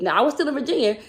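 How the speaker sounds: ordinary talk, nothing marked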